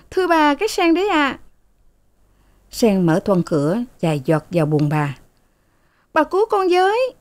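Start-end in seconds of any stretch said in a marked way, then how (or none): 0.1-1.4 put-on high, deferential servant girl; young maid. A narrator's reading. Maid answers mistress. 6.1-7.1 high, frightened plea; pleading. A narrator's reading. Sen begs for help.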